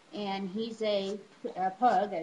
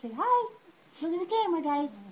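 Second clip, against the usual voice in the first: pet talk; 8 semitones higher, 3 dB louder, faster than usual. Real, not acted. Coaxing dogs toward camera.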